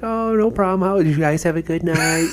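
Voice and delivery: very high, sugary mom voice; sweet mom. Retold or imitated. Buddy's mom asks the boys about their night, high and sweet. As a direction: gush like a doting mom. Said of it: "just sweetest thing".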